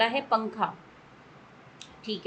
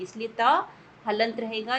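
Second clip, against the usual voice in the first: normal talking voice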